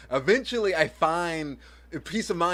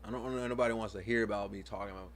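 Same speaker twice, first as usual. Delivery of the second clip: ordinary talk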